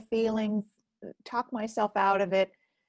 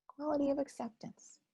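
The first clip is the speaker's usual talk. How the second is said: soft echo; 6 semitones higher, 8 dB quieter than usual. Shown as a demonstration. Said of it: "a quality of acceptance".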